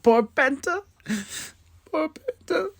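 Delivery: very high squeaky lament; poor-thing falsetto; mock pity. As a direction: pity him in a tiny high-pitched voice. Mock pity for beaten wrestler.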